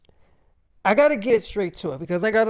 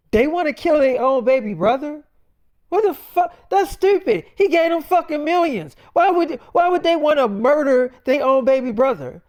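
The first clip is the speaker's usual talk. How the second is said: much higher and louder, shrieking disbelief; voicing the wife, outraged at the betrayal; 7 semitones higher, 5 dB louder than usual. Wife outraged at siblings' plot.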